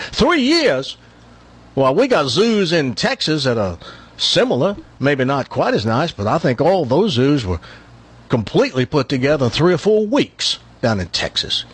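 put-on Texas braggart; bragging Texan. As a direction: boast in a Texas accent. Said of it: "one of these Texans that brags all the time".

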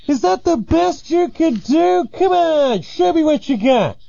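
very high, cocky mock challenge; distant taunting caller. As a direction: dare them from over the dune. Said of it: "they hear".

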